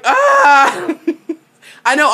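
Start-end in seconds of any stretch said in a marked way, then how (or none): 0.0-1.0 high, loud startled "Ah!"; loud gasp of awe. Retold or imitated. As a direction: gasp out loud as if stunned by a good look. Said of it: "Alec would have been like".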